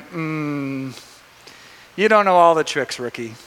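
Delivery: lightly voiced old master, low murmur first. Old master to a rookie, lightly knowing. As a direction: drop low on the last word, a hint of the sage. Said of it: "like the old master going".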